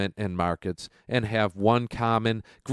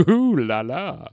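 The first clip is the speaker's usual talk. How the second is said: flirty ooh la la; 5 semitones higher than usual. Pretend. Flirty tease after "expert of sexiness.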